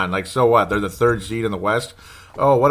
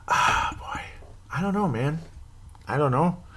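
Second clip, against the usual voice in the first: whispered, dreading; worried whisper; 6 semitones higher, 6 dB quieter than usual. Real, not acted. Nervous fan whispers his dread before the game. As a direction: breathe it out under your breath, worried.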